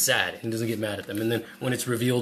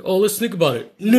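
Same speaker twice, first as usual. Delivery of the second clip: put-on snooty kid voice; 9 semitones higher, 9 dB louder than usual. Kids teasing Richie about playing, high mocking voice. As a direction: tease him with fake consideration. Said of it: "they're like".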